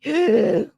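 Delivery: exaggerated mocking gasp for air. Mocking a rival's breathlessness with an exaggerated gasp. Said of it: "what are you out of breath from?".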